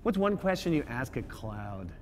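ordinary delivery

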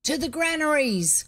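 projected shout to march; crowd's cry. A narrator's reading. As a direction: echo the call as an excited mob. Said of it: "cried half the crowd".

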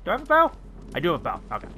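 ordinary talk

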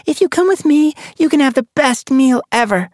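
put-on high, loud coaxing young she-cat; tempting offer. A narrator's reading. Sun Paw tempts her friend, high coaxing voice. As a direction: dangle the prize in front of her.

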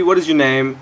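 irritated call-out; annoyed demand. Real, not acted. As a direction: snap the question at the player. Demanding player's name, first take.

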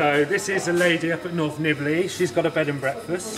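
muffled by a mask; through a face mask. Real, not acted. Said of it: "with my mask on".

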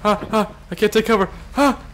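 high frantic laughing; panicked laugh. Real, not acted. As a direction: laugh in panic under fire. Pinned under fire.

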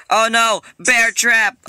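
high, loud cry of alarm; alarmed shout. Real, not acted. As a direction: yell out as the trap gets you. Steps into trap in game.

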